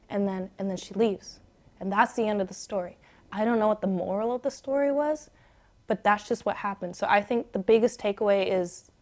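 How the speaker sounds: softly winding the story down; hushed bedtime voice. Real, not acted. Winds the story down for sleepy listener.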